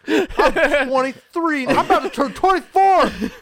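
loud, high-pitched real dismay with laughter; laughing, appalled outburst; shocked realization. Real, not acted. Realizing how long ago it was.

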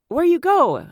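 mock pushy driver asking; lightly voiced insistent driver; imitating taxi driver. Voicing the driver.

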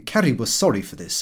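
plain reading voice

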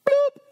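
high, loud cartoon bloop; silly splash noise; bloop sound effect. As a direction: pop the word out like a cartoon plop. Wolf falls into pot.

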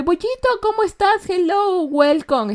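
loud, very high cutesy welcome; high-pitched greeting. Real, not acted. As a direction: greet Bollito in a high, excited singsong. Greets Bollito, who just arrived. (saludo agudo y cariñoso)

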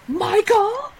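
loud, high, steeply rising name; questioning call-out. Acted. Co-host echoing the sitcom quote, loud questioning call-out. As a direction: belt the name as an incredulous question.

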